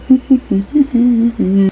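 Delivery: long chuckle trailing on; gleeful laugh. Real, not acted. Reader relishing a coming fight in the book, gleeful laugh. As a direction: giggle away in anticipation.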